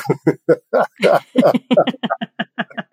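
long infectious giggle tailing off; contagious group laughter. Shown as a demonstration. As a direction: laugh to set others off. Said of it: "It turns into contagious laughter".

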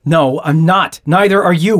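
exasperated rebuttal; heated contradiction. Acted. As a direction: contradict firmly mid-quarrel.

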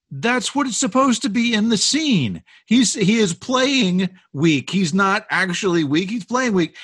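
high-pitched exasperated insistence; exasperated retort. Retold or imitated. Producer defending the actor to casting director, exasperated.

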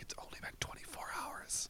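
very soft whisper; hushed confession. Acted. Confessing to a group for sober people, acted whisper. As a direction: whisper it as a guilty secret. Acting out confiding at sobriety group.

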